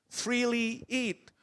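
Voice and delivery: "Freely" drawn out and stressed; slow, weighty "Freely eat"; stressed slowly. Shown as a demonstration. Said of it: "the word free".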